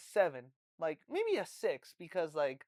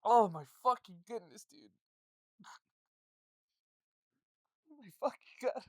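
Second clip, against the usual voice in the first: breathless from laughing; after laughing hard; 4 dB louder than usual. Real, not acted. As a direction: gasp it out, winded from laughter. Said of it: "I can't fucking breathe right now".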